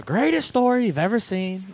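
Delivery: badly faked, boastful rally voice; Donald Trump. Co-host attempts a politician impression, hammed-up and badly done. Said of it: "I can't do a good Trump impression".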